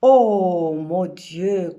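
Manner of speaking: slow, loud, drawn-out cry to God; anguished exclamation. Acted. Mother in labour crying out, slow and loud. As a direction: stretch it out in despair.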